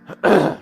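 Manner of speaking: quick throat clear. Real, not acted.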